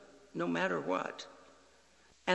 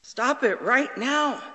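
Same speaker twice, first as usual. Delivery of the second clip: put-on a boy ordering his dad to stop; angry child; 8 semitones higher, 7 dB louder than usual. Son demanding his father stop saying it, angry and shrill.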